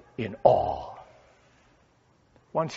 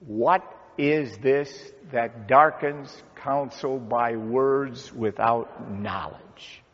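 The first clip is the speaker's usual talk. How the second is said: slow, evenly spaced group reading; measured recitation; much slower than usual. Acted. Pastor leads congregation in saying God's words to Job, measured recitation. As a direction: read it slowly for others to join in.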